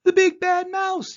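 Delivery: high, loud scream of fright; terrified yell. Acted. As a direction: shriek it and run. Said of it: "yelled the Gruffalo's child".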